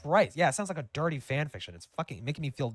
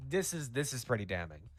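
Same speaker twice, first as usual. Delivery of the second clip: normal talking voice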